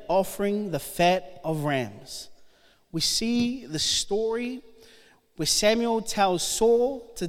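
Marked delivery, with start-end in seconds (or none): none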